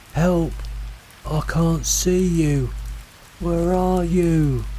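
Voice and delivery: high, slow wailing call; calling for help. A narrator's reading. Lost voice to hikers, drawn-out cry for help. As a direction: draw each word out high into the fog.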